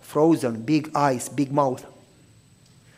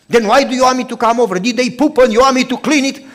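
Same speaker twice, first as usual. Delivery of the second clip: loud, higher, suspicious challenge; suspicious neighbor; 7 semitones higher, 9 dB louder, faster than usual. Acted. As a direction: fire back at him, wary and defensive. Neighbor's suspicious retort, acted.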